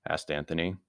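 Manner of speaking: ordinary delivery, nothing marked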